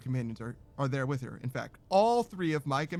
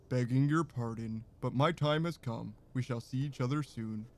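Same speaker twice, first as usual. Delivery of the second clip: put-on lower, gentle old minstrel voice; minstrel's voice; 5 semitones lower than usual. Streamer redoing the lone minstrel's goodbye in a lower minstrel voice. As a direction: drop the pitch for the old minstrel. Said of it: "Minstrel's voice is like".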